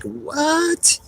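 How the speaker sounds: shocked squeak, jumping way up in pitch; a high, disbelieving yelp; stunned what. Retold or imitated. Retelling his friend's news, jumping up high in disbelief. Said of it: "I was like".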